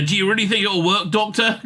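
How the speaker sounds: normal talking voice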